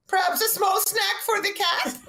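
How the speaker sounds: very high, slow, put-on voice; imitated cat-joking salesman. Friends parroting the salesman's line.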